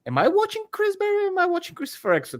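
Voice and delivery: very high, puzzled musing; mock bewildered thought. Viewer wondering which actor he sees, high and amazed. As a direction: ask in amazed disbelief.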